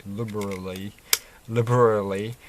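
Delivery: liberally, sounded out syllable by syllable; careful repeated attempts. Real, not acted. Vlogger retrying a hard word while talking about animals, saying "liberally" carefully. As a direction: work carefully through the tricky word "liberally". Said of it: "I find that quite a hard word to say".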